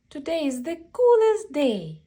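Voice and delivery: loud, high and fast celebration; joyful exclaim. A narrator's reading. Reader celebrates the happy ending loud to children. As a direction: cheer the refrain out.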